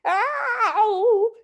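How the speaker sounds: high yelping "Ow"; a sudden pained yelp; scream. A narrator's reading. Husband yelping as wife yanks his hat, high pitched. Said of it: "he screamed".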